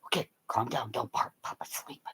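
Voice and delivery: soothing whispered calm-down; whispered hush. Retold or imitated. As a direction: hush the dog so Papa sleeps. Hushing the barking dog.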